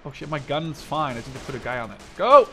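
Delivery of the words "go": loud, high barked go; shouted command. Real, not acted. Gamer yelling at his soldiers to move, loud. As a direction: yell it at your troops. Ordering troops.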